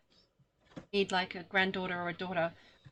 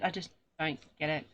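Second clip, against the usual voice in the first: usual speaking voice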